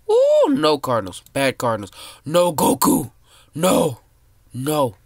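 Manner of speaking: loud, high cries of no; alarmed yell. Real, not acted. Kid gaming, yelling at enemy players about to eat him. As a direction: shout as a predator closes in.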